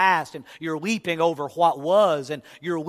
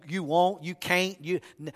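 usual speaking voice

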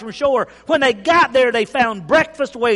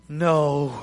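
dejected no; 6 semitones lower, 4 dB quieter than usual. Acted. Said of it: "we gotta say that like they said it".

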